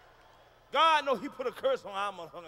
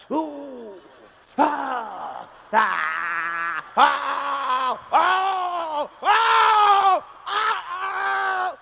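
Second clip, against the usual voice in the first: mock orgasm moans rising to shouts; 4 semitones higher, 10 dB louder, much slower than usual. Mock climax for the crowd, building to shouting ahs. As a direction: ham up a long climax, louder each ah.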